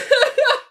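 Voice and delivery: very high, loud squeal of laughter. Real, not acted. Continued laughing fit.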